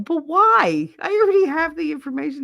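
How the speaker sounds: high, quick, incredulous comeback; exasperated protest. Retold or imitated. Daughter refusing to hear a story twice, exasperated. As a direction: protest it high and fast, fed up. Retold quarrel with repetitive mother.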